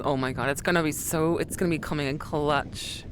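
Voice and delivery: usual speaking voice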